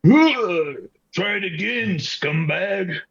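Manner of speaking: slow, high mocking taunt. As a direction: sneer at the foe. Taunting the enemy monster.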